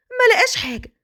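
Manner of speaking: ordinary delivery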